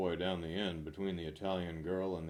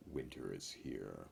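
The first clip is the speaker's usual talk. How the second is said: ominous deep; 11 semitones lower, 9 dB quieter than usual. Pretend.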